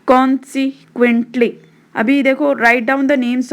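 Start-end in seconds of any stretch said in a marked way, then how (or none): 0.1-1.5 consequently slowly broken into beats; split into syllables. Shown as a demonstration. Teacher showing over three syllables, consequently said piece by piece. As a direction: pause between each syllable of consequently. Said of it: "More than three syllable".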